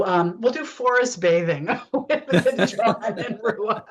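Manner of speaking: laughing. Real, not acted. Jokey show title.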